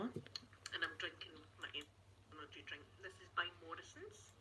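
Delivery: muted. Shown as a demonstration. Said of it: "There, I've muted it".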